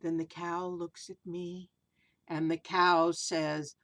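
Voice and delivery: sing-song rhyming read-aloud, slow in places; storybook verse reading; reading to children. A narrator's reading. Reads rhyming picture book aloud.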